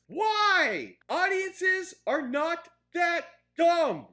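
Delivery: high, loud, slow indignation; exasperated shout. Real, not acted. Reviewer defending audiences against condescending writers, loudly exasperated. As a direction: land each word hard and separately.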